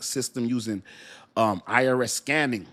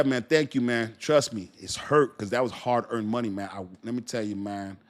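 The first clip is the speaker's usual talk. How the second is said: ordinary talk, nothing marked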